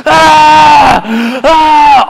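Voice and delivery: loud high fake crying wail; mock fake wail. Dad imitating son's fake crying wail, loud and high. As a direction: wail like a kid faking tears. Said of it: "he really steps it up a notch in his crying".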